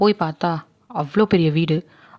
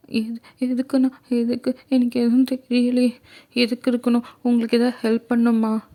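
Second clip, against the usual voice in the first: put-on high, puzzled small girl's voice; innocent confused girl; 5 semitones higher than usual. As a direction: ask innocently, high and unsure. (புரியாமல் கேட்கும் அப்பாவி சிறுமி)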